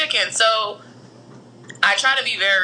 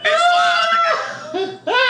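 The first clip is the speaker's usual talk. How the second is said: words breaking into laughs; laughing; slower than usual. Real, not acted. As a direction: talk through high laughter. Laughing while telling.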